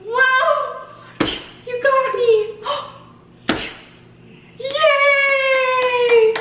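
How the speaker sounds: high, loud cheering. Real, not acted. Cheering baby's play.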